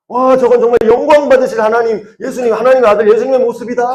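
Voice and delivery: high, loud gushing praise; shouted acclaim. Acted. Crowd cheers Jesus riding in, shouted acclaim. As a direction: shout in awe like an onlooker. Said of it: "막 소리를 질렀단 말이죠".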